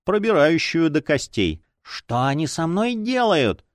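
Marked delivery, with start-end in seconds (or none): 1.8-3.7 whimpering plea. A narrator's reading. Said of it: "захныкал он".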